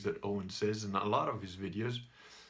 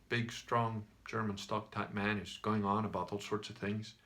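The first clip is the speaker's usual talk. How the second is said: ordinary talk, nothing marked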